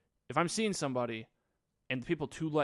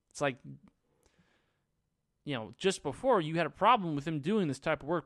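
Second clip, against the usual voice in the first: usual speaking voice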